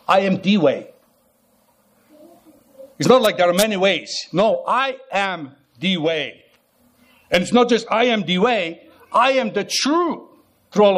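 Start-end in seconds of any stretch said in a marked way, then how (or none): 4.3-6.5 very slow, leaning on I; slow emphatic claim. Acted. Preacher pressing Jesus' claim on listeners, very slow. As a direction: draw out No, I am the way.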